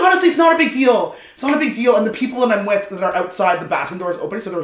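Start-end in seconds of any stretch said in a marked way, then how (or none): 0.0-1.9 high, loud nervous reassurance; smooth it over awkwardly; nervous placating. Retold or imitated.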